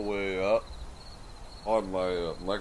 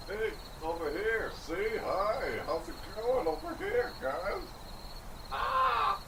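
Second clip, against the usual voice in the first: distant high-pitched calls and squeals; high calls from afar; 10 semitones higher, 5 dB quieter, slower than usual. Acted. Greeting chat from across the room, high squeals.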